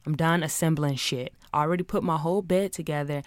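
ordinary talk, nothing marked

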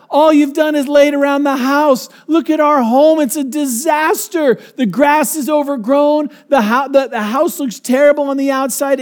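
high, loud scolding wife voice; nagging wife. Retold or imitated. Wife scolding surfer husband about the house, loud nagging. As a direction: tear into him for lazing around.

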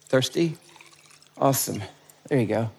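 soft, low welcoming host, almost hushed; quiet hospitable welcome; gentle host voice. Acted. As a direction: greet them softly like a kind waiter. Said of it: "And he says".

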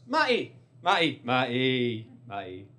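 drawn-out calling of the name, lightly voiced; calling his friend over and over on the phone; Adam calling his name. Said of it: "That's how he talks".

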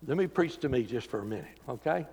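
ordinary delivery

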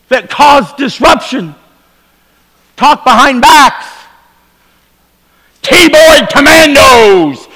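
drawn-out scolding sermon voice; slow pointed preaching. Acted. Pastor calling out online critics, slow pointed preaching. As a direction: lean on each word, slow and pointed.

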